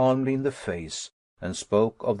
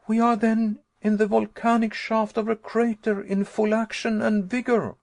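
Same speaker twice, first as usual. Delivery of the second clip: high, loud alarm; can't believe where we are; horrified realisation; 10 semitones higher, 3 dB louder than usual. Acted. Nephew realising they are in a crater, high and alarmed.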